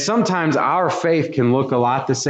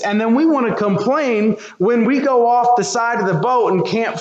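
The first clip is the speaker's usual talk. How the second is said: higher, driving sermon climax; preaching at full force; 9 semitones higher than usual. Acted. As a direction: press the congregation with rising fervor.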